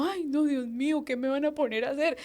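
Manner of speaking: high, soft mock-panicked plea; retold dread. Praying as the leader picks her, retold dread. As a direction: plead softly up high, dreading it.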